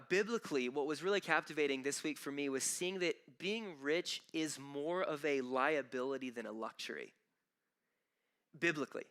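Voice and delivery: usual speaking voice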